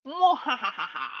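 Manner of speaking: loud high cackling mwahahaha; villain laugh. Pretend. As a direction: cackle like a mad scientist. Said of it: "of a villain laugh".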